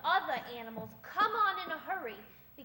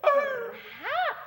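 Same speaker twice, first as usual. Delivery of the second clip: sly tease; 8 semitones higher, 8 dB louder than usual. Acted. Cat teasing dog ominously.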